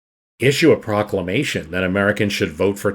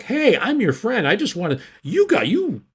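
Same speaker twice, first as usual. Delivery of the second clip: much higher, mock-friendly false-friend voice; concern trolling; 12 semitones higher than usual. French diplomat feigning friendship to the Americans, high mock-friendly. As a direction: play the fake friend, pitched way up. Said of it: "a little bit of concern trolling".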